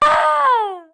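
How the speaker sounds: hammed-up wordless bawling; mock sobbing wail. Wails after the death.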